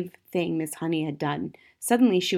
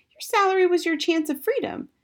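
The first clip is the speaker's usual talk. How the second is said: put-on high, insistent child's voice; protesting child; 12 semitones higher than usual. A narrator's reading. Matilda objecting to Miss Honey, high and insistent. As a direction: object like a dismayed little girl, very high.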